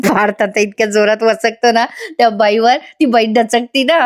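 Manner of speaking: laughing through the retelling; can't hold back the giggles telling this bit; real laughter. Real, not acted. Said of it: "हसायला आलं मला".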